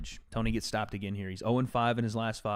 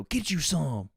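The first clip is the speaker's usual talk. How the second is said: imitating Caleb Plant; 6 semitones higher, slower than usual. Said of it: "And then Caleb go".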